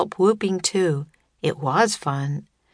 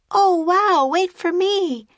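high, loud excited call; 13 semitones higher, 4 dB louder than usual. A narrator's reading. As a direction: call after her across the snow. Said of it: "called Annie".

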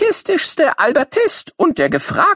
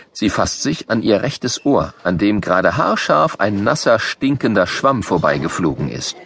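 ordinary delivery, nothing marked

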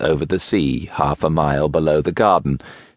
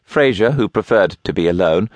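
usual speaking voice

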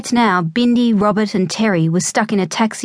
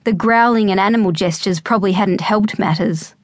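ordinary delivery

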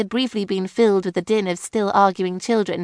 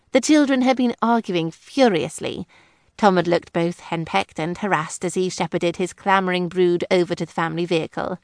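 ordinary delivery, nothing marked

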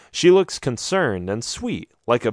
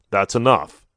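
stern rebuke; 2 semitones lower, 4 dB louder than usual. A narrator's reading. Father rebuking his daughter for talking too much. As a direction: shut her down with authority. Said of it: "rebuking her".

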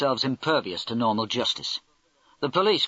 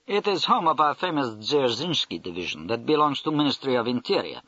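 put-on Russian accent; 3 semitones higher than usual. Russian major describes his base.

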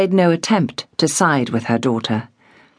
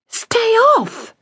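much higher, blazing disbelief; indignant girl. A narrator's reading. Girl told to stay off work, indignant. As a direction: flare up in outrage. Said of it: "Polly's eyes blazed".

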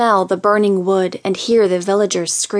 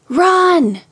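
urgent shout to the villagers; cried out; 10 semitones higher, 4 dB louder than usual. Acted. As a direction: yell it as a warning. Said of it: "she cried out".

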